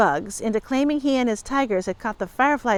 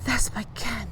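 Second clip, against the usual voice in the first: murmuring; 7 dB quieter than usual. A narrator's reading. Fanchon spots her can, murmuring. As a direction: say it quietly so only your friend hears. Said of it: "Fanchon murmured".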